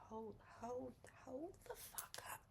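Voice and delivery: whispered hold up; suspicious whisper. Retold or imitated. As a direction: stop them in a hushed whisper. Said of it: "They like".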